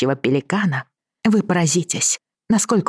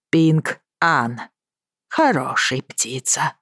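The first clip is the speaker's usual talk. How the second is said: put-on Chinese accent; much slower than usual. Mr Willoughby's broken Russian.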